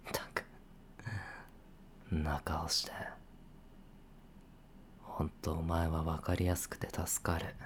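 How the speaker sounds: soft, whispered grumble to himself; whispered muttering. Acted. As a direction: mutter fondly under the breath. 照れ隠しの独り言. (照れ隠しに小声でぼやく)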